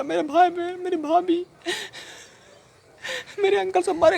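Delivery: very high, grief-stricken lament; anguished wailing. Acted. Man telling sahab his family died, anguished wailing. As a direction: wail it out, voice pitched far up.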